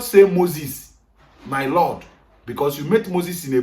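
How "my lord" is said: ordinary delivery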